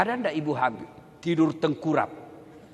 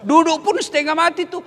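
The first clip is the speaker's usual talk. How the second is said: high, loud mock strain; mock struggle; 10 semitones higher, 7 dB louder than usual. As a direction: act out the effort of sitting. Mimics pregnant woman sitting.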